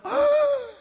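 very high, loud sustained squeal of amazement; amazed gasp. Acted. Student shown a precious study summary, exaggerated amazed squeal. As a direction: let out a hammed-up high gasp.

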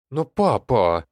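put-on loud, high-pitched outraged girl; indignant protest. A narrator's reading. Daughter objects to her father, loud and indignant. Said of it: "возмущалась девушка".

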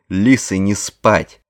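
громкий медленный крик тренера; заорать на команду во весь голос. A narrator's reading. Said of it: "заорал Ваймак". (крик с тренерской скамьи)

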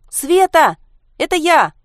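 loud, high-pitched shout through a door; calling a friend. Acted. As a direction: yell to be heard inside. Girl calls friend through the door. (громко и высоко звать подругу через дверь)

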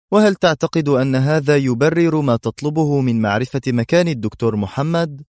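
ordinary delivery, nothing marked

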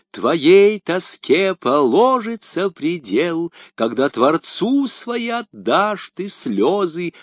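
slow, high declaiming of verse; poem recital. A narrator's reading. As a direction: recite it solemnly, drawing out lines. Aramis recites his rondeau.

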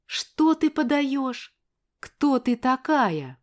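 высокий, медленный, презрительный переспрос; растягивай слова свысока, с недоверием; презрение и недоверие. A narrator's reading. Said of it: "В её голосе было презрение, снисхождение и недоверие". (презрительно, снисходительно, недоверчиво)

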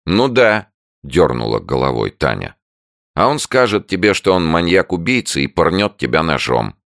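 high, loud sarcastic warning; mock her with a grim joke. A narrator's reading. Tanya scaring her friend.

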